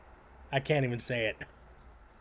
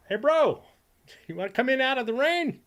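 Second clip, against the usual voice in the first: imitating Dell; 9 semitones higher than usual. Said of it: "That's my favorite Dell line".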